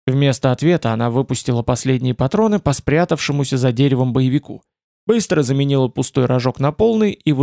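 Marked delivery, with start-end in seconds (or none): none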